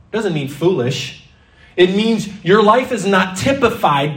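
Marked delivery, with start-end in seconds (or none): none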